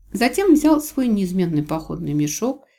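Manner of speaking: ordinary delivery, nothing marked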